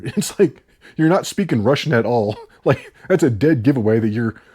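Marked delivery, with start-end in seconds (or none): none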